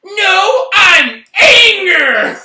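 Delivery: put-on high, loud, slow kid shout; angry little girl. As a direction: yell it like a furious toddler. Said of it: "she was really angry".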